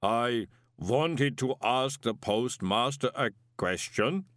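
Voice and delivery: slow, measured inhuman voice; slow golem. A narrator's reading. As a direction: weigh out every word like a golem. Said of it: "said the golem slowly".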